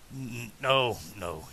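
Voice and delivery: soft, halting stammer on the first word; stammering refusal. A narrator's reading. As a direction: stumble softly into a refusal. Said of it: "he stammered".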